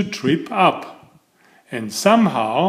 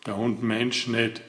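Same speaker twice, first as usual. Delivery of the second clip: low, much softer mutter; 4 semitones lower, 8 dB quieter than usual. A narrator's reading. Soaked farmer to Mister Clumsy, low grumbling mutter. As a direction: grumble it under the breath. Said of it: "muttered the farmer".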